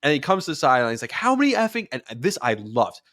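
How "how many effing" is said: high-pitched furious rant, cut off; angry yelling. Retold or imitated. Quarterback Rodgers on the sideline berating his players, high angry yell. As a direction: bark it at the team, voice way up. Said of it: "he's yelling at the team".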